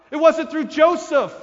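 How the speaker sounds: loud and high, WASNT punched hard; emphatic declaration. Acted. Preacher to congregation, loudly insisting it was not Joseph. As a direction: hit "wasn't" like a hammer.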